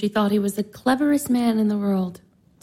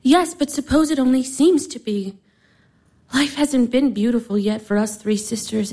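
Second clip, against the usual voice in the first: usual speaking voice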